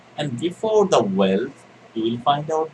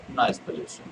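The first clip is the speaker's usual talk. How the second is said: breathy whisper, no voice; hushed reveal of the answer; 8 dB quieter than usual. Real, not acted. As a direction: let the answer slip out in a whisper. Teacher revealing answer, unvoiced.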